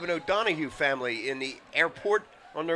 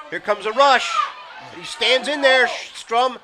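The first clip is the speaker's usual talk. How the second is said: loud, raised live commentary voice; excited play call; 7 semitones higher, 8 dB louder than usual. Real, not acted. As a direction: call the rush with rising urgency. Live call of a pass rush.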